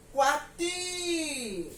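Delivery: "Coati" with a long, high, held TI; high drawn-out TI ending "Coati"; stress on the last syllable. Shown as a demonstration. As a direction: call out Coati, stretching the final "ti". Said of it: "eu vou dizer javali".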